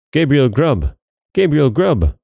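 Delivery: high exclaimed chant, falling at the end; wild voices. A narrator's reading. Goblin chorus answers, mock wild cry. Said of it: "exclaimed the wild voices again".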